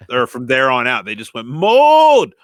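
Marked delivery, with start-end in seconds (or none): 1.5-2.3 loud shout. Retold or imitated. Friend Brett yells at the group, loud shout. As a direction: shout the word mold, high and loud. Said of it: "really loud".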